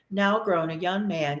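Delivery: ordinary delivery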